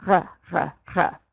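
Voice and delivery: short low "R" sounds from the back of the throat; French throaty R. Shown as a demonstration. Teacher drills a French accent, throaty R repeated. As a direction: rasp each "R" separately in the throat. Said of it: "Back of your throat".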